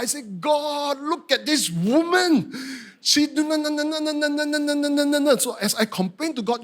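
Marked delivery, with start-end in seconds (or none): 0.4-2.5 high-pitched exasperated complaint; aggrieved, drawn-out protest; complaining to God. Retold or imitated. 3.0-5.4 high monotone rapid-fire grumbling; babbled, high-pitched griping; complaining. Retold or imitated.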